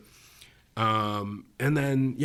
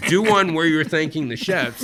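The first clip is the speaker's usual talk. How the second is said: louder, higher repeat as an order; insistent producer; 4 semitones higher, 6 dB louder than usual. Retold or imitated. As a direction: press the request as a firm command. Producers insist after his refusal.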